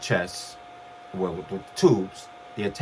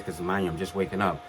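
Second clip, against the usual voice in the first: normal talking voice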